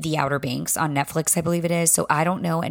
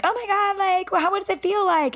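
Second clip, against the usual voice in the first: gushing friend. Retold or imitated.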